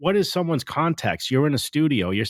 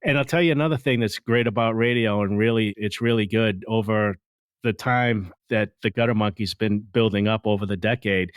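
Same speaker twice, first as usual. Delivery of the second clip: ordinary delivery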